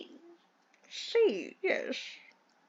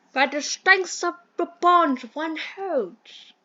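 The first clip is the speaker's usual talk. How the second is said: posh British gentleman. Acted. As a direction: play the fussy English employer in a mild posh accent. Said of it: "I hate this accent".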